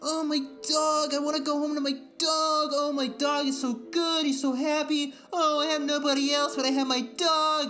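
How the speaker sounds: hammed-up lonely dog owner, whiny and sappy; mocking dog lovers. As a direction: coo it like a lonely dog owner gushing over their pet. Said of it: "They're just like".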